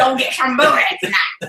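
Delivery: put-on pirate that comes out like a prospector; hammed-up old-timey pirate send-off. Wife retrying her sign-off to viewers, pirate turned prospector. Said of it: "You sound like a prospector".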